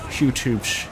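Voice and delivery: upbeat YouTuber intro voice. Shown as a demonstration. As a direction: say it like a YouTube host. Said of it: "you'll get to hear the YouTuber voice too".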